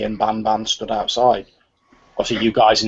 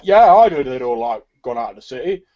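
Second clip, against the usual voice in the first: crowd member voice; 3 semitones higher, 6 dB louder than usual. Acted. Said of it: "someone at the back's like".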